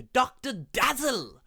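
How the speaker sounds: high, loud showy character name; hammed-up, swooping name-drop; Doctor Dazzle voice. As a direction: announce it in a silly, high put-on voice. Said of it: "doing that voice".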